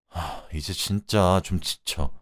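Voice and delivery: very slow, sighing admission; weary sigh. Acted. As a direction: sigh it out as a worn-out husband. Husband gives in, worn out. (한숨 섞인 지친 말투)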